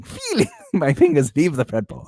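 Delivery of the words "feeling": high mock emphasis. Mocking a word from post.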